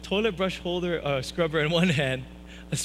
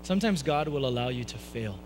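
ordinary talk

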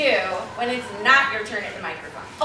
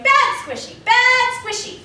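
high, loud mock scolding; Poseidon scolding; 11 semitones higher, 5 dB louder, slower than usual. Poseidon stung by a jellyfish, high loud scolding. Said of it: "while Poseidon's going".

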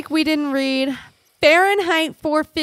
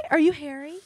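usual speaking voice

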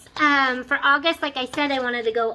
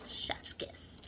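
whispered chef's kiss; 20 dB quieter than usual. Real, not acted. Praising packaging.

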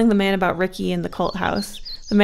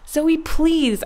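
high, loud desperate cry; desperate shout; 8 semitones higher, 6 dB louder than usual. A narrator's reading. Scared girl shouts after her friend walking off. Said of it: "I shouted".

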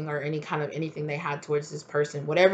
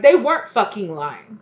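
loud, high, slowed furious accusation; the partner calling out the liar, loud and furious; angry accusation; 3 semitones higher, 8 dB louder, slower than usual. Retold or imitated. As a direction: throw it back at them, loud. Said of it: "And they're like".

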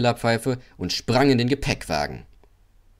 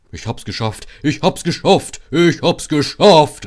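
triumphant cry building to very loud; proud shout; 6 semitones higher, 9 dB louder than usual. A narrator's reading. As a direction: crow it out, each time bigger. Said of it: "rief er stolz".

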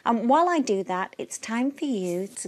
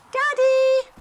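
very high, loud glad shout; joyful cry; 8 dB louder than usual. A narrator's reading. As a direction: cry out with relief on hearing him. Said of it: "cried Little Rabbit".

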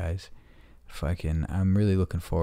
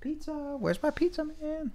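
very high squeaky voicing of the cat; squeaky pretend cat; 4 dB quieter than usual. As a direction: speak for the cat in a tiny high voice.

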